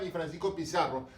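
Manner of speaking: ordinary talk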